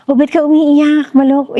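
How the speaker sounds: teasing elder. Retold or imitated. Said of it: "Yung sa Filipino".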